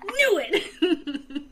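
laughing, high "I called it!"; triumphant, laughing gloat; gleeful gloating. Retold or imitated. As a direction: crow it while laughing. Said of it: "Tiro's like".

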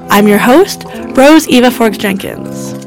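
ordinary talk, nothing marked

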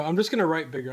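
ordinary talk, nothing marked

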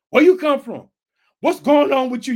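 loud, challenging retold questions; acted interrogation. Preacher playing sailors questioning Jonah, loud demanding. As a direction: confront him as an alarmed sailor would.

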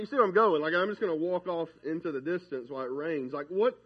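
normal talking voice

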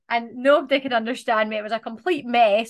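usual speaking voice

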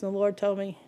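ordinary talk

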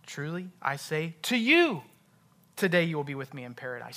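emphatic YOU in "to you"; stress on YOU. Shown as a demonstration. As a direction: hit "you" hard, pause, then read on quickly. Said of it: "just emphasize a different word".

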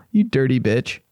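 ordinary talk

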